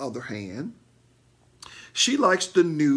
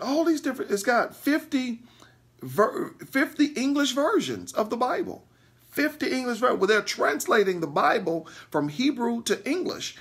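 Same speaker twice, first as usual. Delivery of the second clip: excited; 8 semitones higher than usual. Real, not acted. Amazed by fifty Bible versions.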